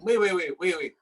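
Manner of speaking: usual speaking voice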